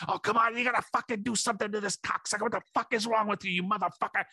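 high, fast mock ranting at the ref; hammed-up screeching trainer yelling at the referee. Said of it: "he starts yelling at the referee".

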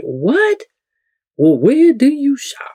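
high-pitched mock astonishment; retold disbelief. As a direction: imitate someone baffled by the answer. Retelling a stunned listener's reaction.

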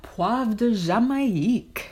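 poivre de Jamaïque in French pronunciation; French accent. Shown as a demonstration. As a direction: say it like a French speaker.

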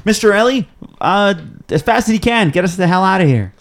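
high, urgent command; urgent order. Acted. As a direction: bark the order to flee. Urgent order to flee dragon.

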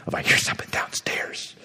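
hushed urgent whisper; wife whispers about a noise downstairs. Retold or imitated. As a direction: whisper to wake a sleeping husband. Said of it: "the wife was nudging the husband".

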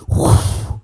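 airy, forceful whoosh; imitated whoosh. Storyteller mimics getting shot back down to Earth, rushing whoosh. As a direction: blow out a loud rushing whoosh.

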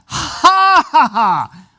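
hammed-up scornful laugh; mock laughter. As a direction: act out the friends scoffing with laughter. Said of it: "And they all laughed".

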